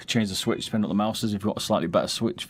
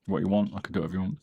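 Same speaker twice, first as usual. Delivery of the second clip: ordinary delivery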